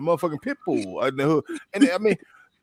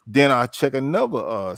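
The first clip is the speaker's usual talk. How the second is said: ordinary talk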